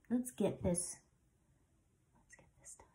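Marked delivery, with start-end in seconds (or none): 2.1-2.9 barely audible, voiceless whisper; whispering to self. Real, not acted. Talking to self while getting mascara done, whispered. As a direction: whisper it under the breath while concentrating.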